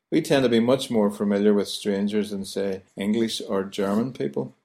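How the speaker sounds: usual speaking voice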